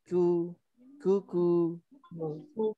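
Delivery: Ku with lips only a little rounded; slightly rounded ku. Shown as a demonstration. Teacher models kaf with dammah, lightly rounded. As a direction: round the lips just a bit. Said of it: "monyongnya tidak memonyong banget".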